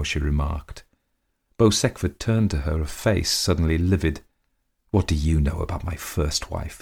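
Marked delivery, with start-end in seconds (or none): none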